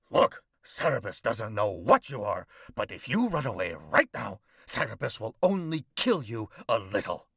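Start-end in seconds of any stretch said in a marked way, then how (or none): none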